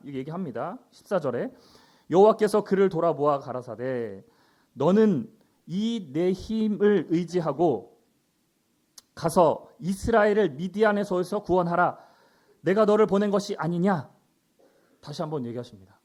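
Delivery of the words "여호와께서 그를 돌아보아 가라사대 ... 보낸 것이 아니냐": loud, commanding divine charge; projected formal Bible verse; scripture reading. Acted. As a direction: read God's order out firmly. Reads scripture aloud.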